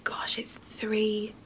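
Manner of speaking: slow whispered shock; hushed sad whisper. Real, not acted. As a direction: breathe it out in disbelief. Realising loss of cat.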